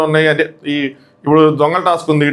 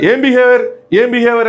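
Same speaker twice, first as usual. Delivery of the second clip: imitating someone snapping back in a fight; a hammed-up angry shout retold on a vlog; 6 semitones higher than usual. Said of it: "first తినే అరిచిద్ది".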